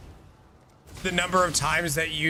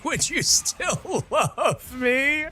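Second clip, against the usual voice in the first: mock whine; 8 semitones higher, much slower than usual. Silly pony question.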